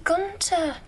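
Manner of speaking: "Gunter" high, falling at the end; name echoed. Acted. Actress repeating the director's name, falling tune. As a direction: echo "Gunter" with a drop at the end.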